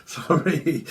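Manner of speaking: normal talking voice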